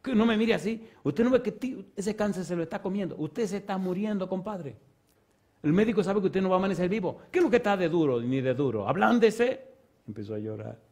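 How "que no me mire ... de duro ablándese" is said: retold rebuke. Retold confronting a dying man.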